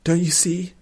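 high, desperate plea; pleading. A narrator's reading. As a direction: plead to be understood. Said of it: "said Nuthia pleadingly".

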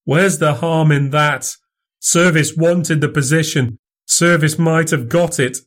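rising, pleading Fettes, pitch climbing; frightened student crying out; a touch of anguish. A narrator's reading. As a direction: protest his innocence, growing desperate. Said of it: "he cried".

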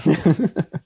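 loud high laugh. Real, not acted. Reacting to the liberals joke, a loud high laugh.

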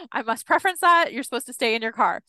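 high, quick caveat after a laugh; laughing disclaimer. Real, not acted. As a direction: rattle off the warning, amused. Laughing disclaimer about safety rules.

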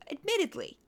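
"admittedly" pitched high and dropping. Shown as a demonstration. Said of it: "And I say this as someone".

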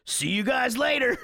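light, high, carefree goodbye; breezy kid farewell. Retold or imitated. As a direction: toss it off like a kid running out. Quoting the son leaving casually.

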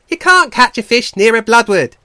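high, very loud contradiction; scoffing otter. Acted. Otter disputes the bear's claim, loud and scoffing. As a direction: scoff at full volume.

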